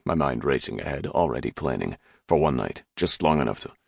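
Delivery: ordinary delivery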